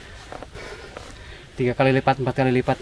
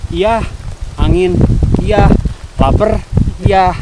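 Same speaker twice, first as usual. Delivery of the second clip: loud, pushing over gusty wind; shouting over wind; 3 semitones higher, 10 dB louder than usual. Real, not acted. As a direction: project loudly so you're heard in the wind. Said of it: "Semoga saya ngomong kedengeran".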